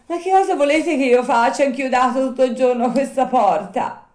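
high, loud puppet protest; exasperated Pinocchio. Acted. Puppet nailed to the door all day, high exasperated protest.

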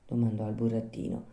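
ordinary delivery

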